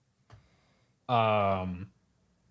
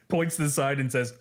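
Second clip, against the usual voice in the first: ordinary talk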